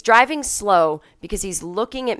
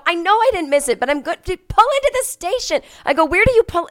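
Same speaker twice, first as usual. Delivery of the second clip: exasperated; 11 semitones higher, 6 dB louder than usual. Retold or imitated. Exasperated passenger arguing with driver.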